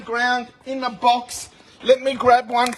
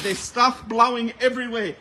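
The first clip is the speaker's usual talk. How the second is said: normal talking voice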